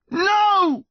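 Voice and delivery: long, high wail; anguished scream. A narrator's reading. Son screaming as his mother is about to fall. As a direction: scream it with everything left. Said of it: "Connor screamed one more time".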